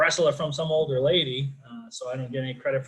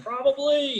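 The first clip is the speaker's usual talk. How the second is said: cheeky; 11 semitones higher, 6 dB louder, slower than usual. Retold or imitated. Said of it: "I was like".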